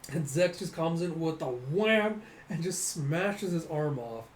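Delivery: ordinary talk, nothing marked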